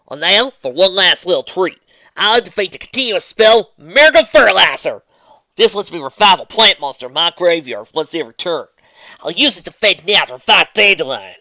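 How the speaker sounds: put-on country girl calling her move; Southern accent. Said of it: "doing a Southern voice for a duel".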